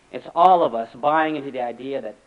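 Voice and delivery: ordinary delivery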